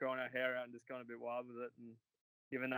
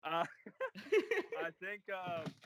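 laughing; 6 semitones higher, 4 dB louder, slower than usual. Real, not acted. Laughing while answering.

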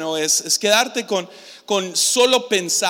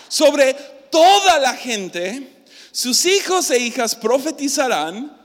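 slow, louder call of toda; proclaimed with the crowd; 6 semitones higher, 5 dB louder, slower than usual. Shown as a demonstration. Leader having the crowd shout the verse with him, slow and louder. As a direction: lead the crowd in saying it. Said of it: "pueden decirlo en voz alta".

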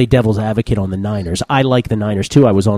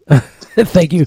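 laughing thanks; 6 semitones higher, 5 dB louder than usual. Real, not acted. Laughs at cohost's rant.

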